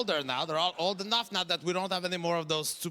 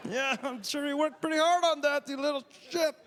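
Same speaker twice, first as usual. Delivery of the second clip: higher, mock-sweet sarcasm; sarcastic; 7 semitones higher than usual. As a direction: fake praise with a sneer underneath. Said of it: "I'm just like".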